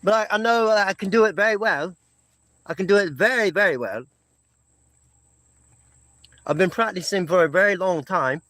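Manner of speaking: put-on English accent, showing off. Shown as a demonstration. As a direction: boast in your English impersonation. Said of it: "English accent, uh, impersonation".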